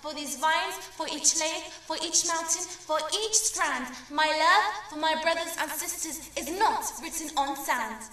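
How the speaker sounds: loud, high, fervent declaration of love for the land; impassioned shout. Acted. Poet voicing her lover screaming his love for Syria, impassioned shout. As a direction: cry it out with everything. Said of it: "He screams".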